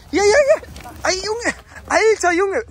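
high, worked-up exclamations; agitated exclaiming. Real, not acted. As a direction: blurt it out high and rattled. After falling on steep path.

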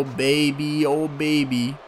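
ordinary talk, nothing marked

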